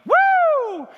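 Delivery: a sudden very high, loud party whoop; wife mocking her own party day with a shrieked woo.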